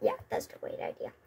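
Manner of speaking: whisper. Real, not acted. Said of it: "whispered aside".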